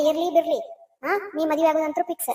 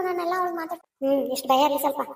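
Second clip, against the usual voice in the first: usual speaking voice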